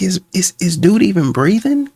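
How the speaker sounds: watching a creepy clip, stunned and incredulous. Retold or imitated. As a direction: ask it like you can't believe your eyes. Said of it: "I was like".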